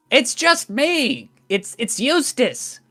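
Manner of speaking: high-pitched gnome calling up; gnome cart driver. Acted. Eustace identifying himself to the wall guard, high-pitched calling. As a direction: pipe up high, pleading to be recognised. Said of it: "Eustace stands up on the cart".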